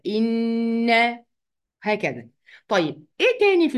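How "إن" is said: "إن" with a long held nasal hum on the n; doubled noon ghunnah. Shown as a demonstration. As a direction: lean on the n of "إن" and let it hum. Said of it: "أغنها هكذا".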